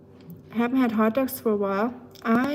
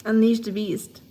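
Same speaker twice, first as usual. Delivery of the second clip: ordinary talk, nothing marked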